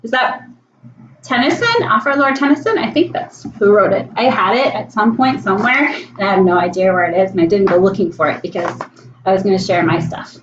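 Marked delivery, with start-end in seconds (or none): none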